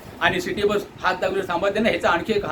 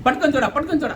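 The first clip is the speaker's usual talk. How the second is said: raised, high mock order to board fast; mocking conductor's rush call; hurrying conductor; 7 semitones higher than usual. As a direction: bark it like an impatient conductor. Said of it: "खूप घाई करण्यात येतं conductor ड्रायव्हरकडून".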